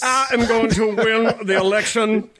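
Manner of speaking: hammed-up political preacher cadence; mock preacher candidate. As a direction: preach it like a campaigning pastor. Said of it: "racist attitudes".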